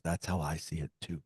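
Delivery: low, very soft retold confiding; hushed, low and confidential; hushed revelation. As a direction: drop right down as if letting a secret out. Retold quiet revelation from fellow medium.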